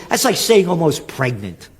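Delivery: usual speaking voice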